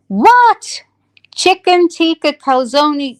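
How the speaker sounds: high, loud, scandalised mock disbelief; mock outrage. Scolding herself over last night's calzone, mock outrage. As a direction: exclaim in hammed-up horror, drawing out the dish.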